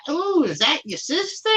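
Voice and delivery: mock a woman fishing for information; flirty drive-thru woman.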